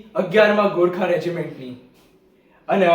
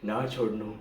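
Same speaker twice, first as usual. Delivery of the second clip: low, faint dying words; very soft, deep-pitched last words; 8 semitones lower, 12 dB quieter than usual. Acted. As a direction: breathe out a final order. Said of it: "મારા છેલ્લા શબ્દો હતા".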